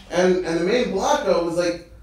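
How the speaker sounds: ordinary talk, nothing marked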